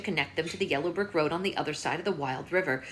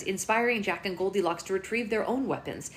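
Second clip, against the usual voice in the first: ordinary delivery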